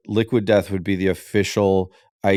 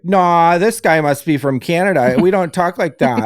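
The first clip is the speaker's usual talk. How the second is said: higher, louder, mocking Wisconsin accent; mock Wisconsin commenters; 7 semitones higher, 4 dB louder than usual. Mocking online commenters from Wisconsin, higher, louder Wisconsin accent. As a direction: ham up offended Wisconsin locals in their own accent. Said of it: "all the Wisconsin people are like".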